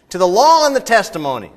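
loud, high proclamation; rallying cry. Acted. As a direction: shout it like a battle cry.

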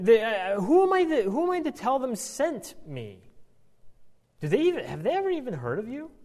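Moses spiralling into worry; rising panicked objection. Retold or imitated.

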